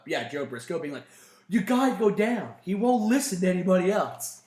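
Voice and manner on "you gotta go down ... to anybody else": higher, imploring retold appeal; lightly acted urgent warning; acted urgent plea. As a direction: plead it like a worried go-between. Voicing Briscoe's plea in story.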